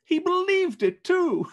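Fred gleefully adds the punchline; nephew crying out. Acted. Fred laughing to his guests about Scrooge, high and loud. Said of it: "cried Scrooge's nephew".

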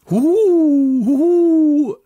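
a high, loud, drawn-out ghostly hoot; eerie hooting. A narrator's reading. The unseen owl calls out over the castle courtyard, eerie loud hooting. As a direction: hoot like an owl in the dark night. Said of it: "ertönte es plötzlich".

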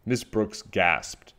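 ordinary delivery

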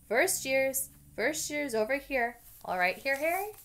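big friendly giant calling first years. Acted. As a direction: call the crowd over. Hagrid calling out.

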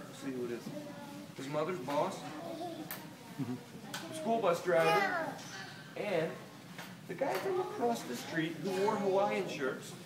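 usual speaking voice

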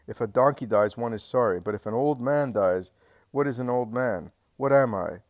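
put-on an old man pleading his worth, loud; bitter lament. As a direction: cry out the unfairness of it.

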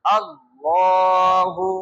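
আল্লাহু very slow, the middle vowel held long; three sounds joined. Shown as a demonstration. Teacher has class repeat the three sounds together, slow drawn-out আল্লাহু. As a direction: stretch আল্লাহু out in one joined call. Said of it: "বলেন".

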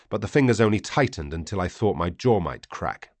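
plain reading voice

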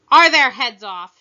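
loud demanding shout. A narrator's reading. Queen demands news of the execution, shouting. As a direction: yell it across the garden. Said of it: "shouted the queen".

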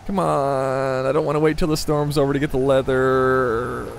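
long, drawn-out whine; whiny. Real, not acted. Player doesn't want to wait till the storm's over, whining. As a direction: whine it, stretching the first and last words. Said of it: "Wow, that was really whiny".

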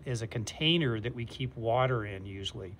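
ordinary delivery

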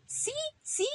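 very high, soft squeak; tiny child's eager yes; little rabbit insisting. Acted. Little Ramón insisting to mother.

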